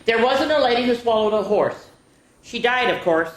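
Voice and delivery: lively storybook read-aloud; reading to children. Acted. Teacher reading a picture book to children, lively. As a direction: read it out to the kids with bounce.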